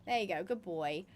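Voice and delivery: soft praise to the horse. Real, not acted.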